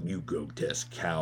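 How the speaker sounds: a low, quiet mutter at her back; muttered insult. Retold or imitated. Husband mutters an insult as his wife turns away. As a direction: say it under your breath.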